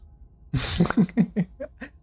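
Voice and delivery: a high burst of laughter; delighted laugh. Real, not acted. Laughs at game line.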